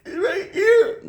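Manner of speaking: put-on very high, small girl pointing; little girl voice. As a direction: answer timidly like the niece. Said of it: "She's like".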